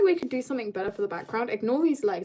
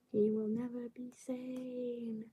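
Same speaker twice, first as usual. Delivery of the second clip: soft ominous aside; 14 dB quieter, slower than usual. Acted. Quiet aside about her character.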